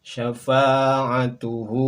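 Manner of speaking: "Syafa'atuhu" very slow and loud, ending tuhu; model Arabic reading. Shown as a demonstration. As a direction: stretch out "Syafa'atuhu". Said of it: "samakan dengan contoh pelafalan kami". ("Syafa'atuhu" sangat pelan)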